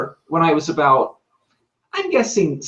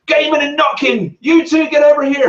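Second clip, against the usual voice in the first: high, loud teacher yelling; stern teacher shout; 13 semitones higher, 4 dB louder than usual. Retold or imitated. Teacher catching boys skipping school, shouting. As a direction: call the truants back like a furious teacher. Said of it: "the teacher would go".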